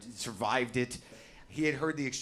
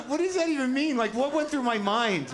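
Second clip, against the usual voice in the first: ordinary delivery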